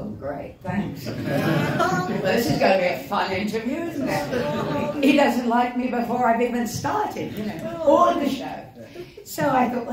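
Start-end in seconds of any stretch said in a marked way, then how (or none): 0.0-1.1 sarcastic thanks. Retold or imitated. 1.1-8.6 wry laugh through the words, rising higher; amused sarcasm at a hostile interviewer; laughing disbelief. Retold or imitated. Actress before a live interview, laughing wryly.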